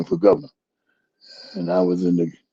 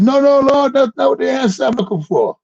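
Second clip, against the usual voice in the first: high, loud panicked no; frantic refusal; 4 dB louder than usual. Retold or imitated. Jim refusing God's command to let go, high loud panic.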